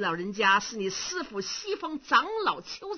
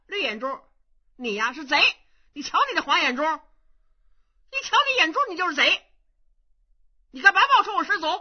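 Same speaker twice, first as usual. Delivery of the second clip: louder, rising to a shout; angry young monk; 5 semitones higher, 6 dB louder than usual. Acted. Young monk accusing the impostor over his eye color, furious. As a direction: interrogate him and call him a thief.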